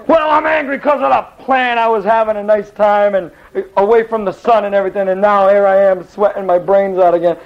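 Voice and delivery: high-pitched, hammed-up angry complaint; angry Jonah. Jonah answering God about the dead plant, mock angry. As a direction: gripe indignantly in a mock whine. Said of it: "Why are you angry?".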